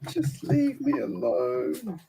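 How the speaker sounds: high, slow grumpy whine; mock grouch. Grumbling at his friends in mock sulk, high and slow. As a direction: drag it out like a sulky grouch. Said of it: "No, not that much".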